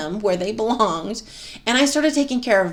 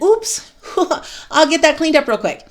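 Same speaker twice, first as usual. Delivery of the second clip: bright, high-pitched, breezy "oops" with a giggle; cheerful mock oops; 7 semitones higher than usual. Self-talk after spilling something, cheerful and light. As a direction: toss it off lightly, no big deal. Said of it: "that's a different feeling than".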